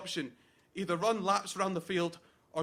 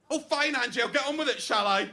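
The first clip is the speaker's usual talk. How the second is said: higher, louder irritated concession; exasperated; 5 semitones higher, 5 dB louder than usual. Acted. Best man giving in to Angela's interruption, loudly exasperated. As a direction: give in with loud, huffy impatience.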